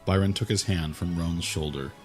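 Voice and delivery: usual speaking voice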